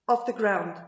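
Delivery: ordinary delivery, nothing marked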